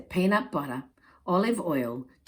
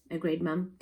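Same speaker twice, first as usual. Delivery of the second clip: ordinary delivery, nothing marked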